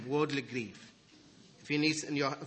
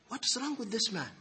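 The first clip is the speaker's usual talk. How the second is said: mock resentful inner voice, pitched up; voicing the brother; 9 semitones higher, 5 dB louder than usual. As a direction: play the annoyed brother muttering, then rising high. Brother's imagined inner struggle voiced.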